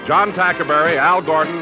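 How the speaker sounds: ordinary talk, nothing marked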